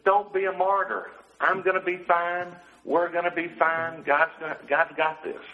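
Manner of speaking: husband quoting wife's brisk comfort, pitched high; imitating wife. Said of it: "she said".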